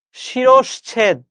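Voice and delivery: শিরশ্ছেদ very slow and clear for learners to repeat; say-after-me pronunciation. Shown as a demonstration. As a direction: model শিরশ্ছেদ slowly for the class. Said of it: "আমার সাথে উচ্চারণ করো". (আমার সাথে বলো, ধীরে শিরশ্ছেদ)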